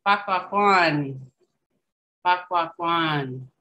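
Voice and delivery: Pakwakan said slowly, each syllable spelled out for learners; slow teaching demo. Shown as a demonstration. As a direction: sound out Pakwakan slowly for the class. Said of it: "teaching Cree word slowly".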